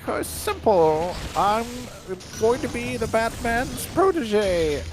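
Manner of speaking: high, slow cartoon frog voice. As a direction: drawl it out like a goofy Flash cartoon frog. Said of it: "are you doing a legendary frog voice".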